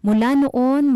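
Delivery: ordinary delivery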